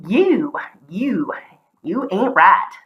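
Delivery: drawn-out Southern "you ain't right"; Southern accent. Shown as a demonstration. Showing how Southerners say you, Southern accent. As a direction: demonstrate a Southern twang on each word. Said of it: "I'm not particularly Southern anymore".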